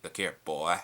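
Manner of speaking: a hint of old Homer calling his boy; original Homer voice. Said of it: "I like the original voice".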